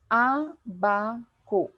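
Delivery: abaco very slowly split as Á... ba... co; drawn-out abaco, first syllable stressed. Shown as a demonstration. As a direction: break abaco into three slow syllables. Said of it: "En la antepenúltima sílaba".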